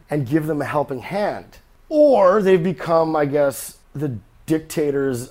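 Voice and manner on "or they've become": ordinary delivery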